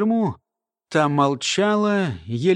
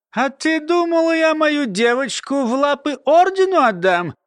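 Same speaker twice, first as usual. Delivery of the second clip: put-on high, louder old crone mumbling through gums; toothless mumble; 9 semitones higher, 3 dB louder than usual. A narrator's reading. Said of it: "прошамкала старуха".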